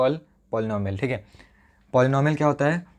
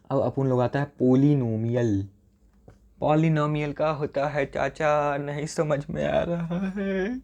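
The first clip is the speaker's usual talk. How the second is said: a hint of a Bombay tapori, hamming it up; lightly put-on street-guy voice, teasing the class; playful street tough. Teacher clowning for his students, lightly voiced tapori. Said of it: "नाटक करने में मज़ा आता है मुझे".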